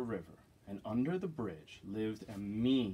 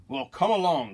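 loud, raised goat challenge; big loud voice; 5 semitones higher, 9 dB louder than usual. Acted. Third goat challenging the troll, big loud voice. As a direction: dare the troll loudly. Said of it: "as big and loud as the troll's".

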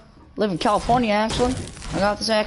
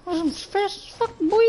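put-on tiny high voice; squeaky catchphrase; 9 semitones higher, slower than usual.

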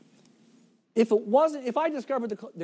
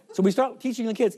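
usual speaking voice

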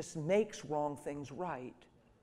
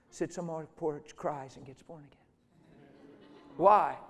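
usual speaking voice

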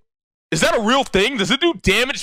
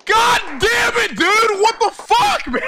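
raging shout; 13 semitones higher, slower than usual. Real, not acted. Streamer yelling at his game, furious shout. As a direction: blow up at the screen.

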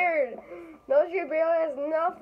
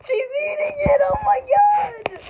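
very high, loud squeal; shrieking excitement; 13 semitones higher, 6 dB louder than usual. Real, not acted. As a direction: shriek it out, stretching the last words. Exclaiming at the toy eating.

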